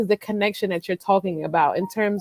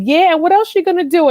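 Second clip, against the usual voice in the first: put-on chatty excited baby talk; 10 semitones higher, 7 dB louder, faster than usual. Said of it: "and then she was like".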